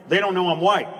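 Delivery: ordinary talk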